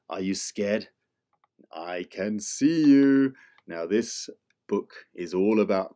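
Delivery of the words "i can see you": usual speaking voice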